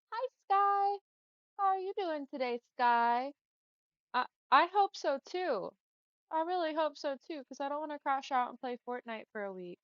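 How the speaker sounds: high, loud, bright hello; excited greeting. Real, not acted. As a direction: greet the viewer with a burst of energy. Greeting a viewer by name.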